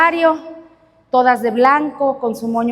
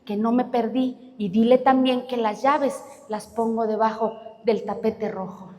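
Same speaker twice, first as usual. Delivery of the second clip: gentle reassurance; 3 semitones lower, 5 dB quieter than usual. Acted. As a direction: soothe a frightened child. The doctor comforts the girl.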